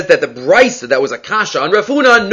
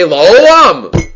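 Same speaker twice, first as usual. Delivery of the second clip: LA'OLAM stretched out and louder; mock emphasis; 5 dB louder than usual. Teacher mocking a needless emphasis in any argument, mock proclamation. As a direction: proclaim la'olam as if it were momentous. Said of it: "What's the emphasis?".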